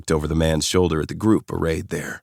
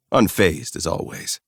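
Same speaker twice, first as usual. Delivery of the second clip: plain reading voice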